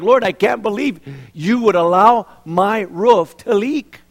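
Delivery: mock whining prayer. Homeowner whining to God about leaky roof. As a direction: moan at God over the leak.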